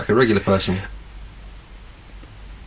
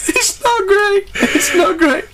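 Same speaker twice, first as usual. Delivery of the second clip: very high tearful mascot wail; cartoon tiger breaking down; mock crying; much slower than usual. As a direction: sob it out in a hammed-up high voice. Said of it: "crying into his cereal".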